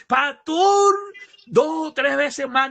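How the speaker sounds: high, loud gushing to the pastor; imitated excitement. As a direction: burst out with good news, hammed up. Acted churchgoer reporting good news.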